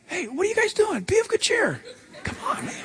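high, soft, easygoing call; breezy and light, almost chuckling; casual Jesus. Retold or imitated. Jesus calling casually to frightened disciples, high and soft.

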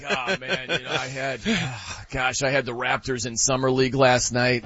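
low, soft lament with a sigh; mock bettor's regret. Bettor lamenting a failed bet, mock dejection. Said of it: "you could be like".

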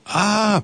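a sharp, high shriek of fright; startled scream. A narrator's reading. Princess finding Delphine in her room, screaming high. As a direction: jump at the sight with a high scream. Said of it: "Princess Beatrice screamed".

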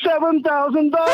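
high, excited cry; thrilled announcement. Real, not acted. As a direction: call out your winnings, thrilled. Caller proudly announces his free silver.

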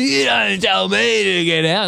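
high, slow, indignant mock protest; drunk troublemaker. A mocked drunk patron answering the bouncer, indignant. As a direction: whine it like an outraged drunk being kicked out. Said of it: "And they go".

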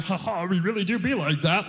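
fake-laugh, put-on agreeable voice; mock agreement. Guest humoring girlfriend's father, falsely agreeable. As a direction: play along with a phony laugh.

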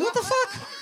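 very high, shocked yelp; startled outburst. Retold or imitated. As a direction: jump out of your skin at the creepy kid. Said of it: "It's like".

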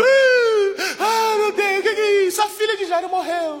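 high, wailing cry of the mourners; mock wailing mourner. Preacher imitating the mourners at Jairo's house, wailing high. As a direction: wail it like the grieving crowd.

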